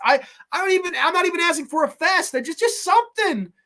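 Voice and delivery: high-pitched, pleading protest; exasperated plea. Real, not acted. Streamer begging for any sleaze in slashers, exasperated plea. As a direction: beg for the bare minimum, voice way up high.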